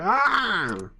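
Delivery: high mock yell of surprise; startled yell. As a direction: let out a sharp mock yelp. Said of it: "What's he yelling about?".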